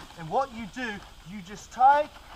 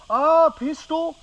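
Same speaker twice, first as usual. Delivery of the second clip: mock excitement; 7 semitones higher, 7 dB louder, slower than usual. Mock host finds a pistol.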